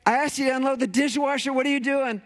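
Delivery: high, fast, fed-up complaint; exasperated spouse. Retold or imitated. Spouse scolding over the dishwasher, exasperated. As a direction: nag about the chores. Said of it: "Your spouse would be like".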